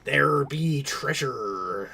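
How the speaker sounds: slow, drawn-out stage pirate accent. Shown as a demonstration. Streamer demonstrating the movie-invented pirate talk, exaggerated pirate accent. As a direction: growl it out as a pirate cliché accent. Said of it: "pirate accent, like the".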